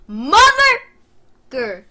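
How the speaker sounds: huge drawn-out high shout; very loud, stretched outburst; furious censored curse. Retold or imitated. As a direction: explode in rage, then swallow the word. Curses at the seventy-five dollar price.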